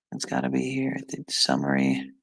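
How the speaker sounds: usual speaking voice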